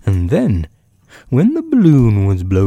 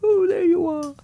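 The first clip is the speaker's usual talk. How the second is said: tiny, very high bear voice; high-pitched little toy bear; Winnie the Pooh. A narrator's reading. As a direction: call down in a small, thin, high voice. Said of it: "called down Winnie the Pooh".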